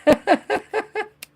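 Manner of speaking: high, light run of giggles; real giggle. Real, not acted. Host after butchering a viewer's name, high giggle. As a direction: giggle to yourself, amused. Laughing over the name mix-up.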